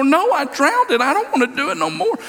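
put-on high, insistent little boy; sulky three-year-old refusing; a hint of a tearful child; upset toddler voice. Son refuses to continue.

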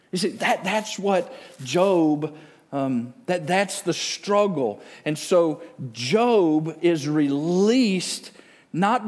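high, loud sermon climax; impassioned preaching. Real, not acted. Preacher telling congregation why Job was released, impassioned. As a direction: drive the point home, leaning hard on key words.

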